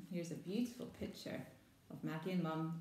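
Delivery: usual speaking voice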